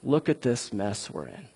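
king quietly showing God the threatening letter; quiet plea. Retold or imitated. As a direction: lay the trouble out before God in a low voice. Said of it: "He's like".

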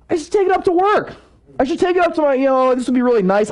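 high-pitched burst of enthusiasm; acted-out eager brainwave; excited idea. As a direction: pitch it up high, thrilled with your own idea. Acting out his sudden bright idea.

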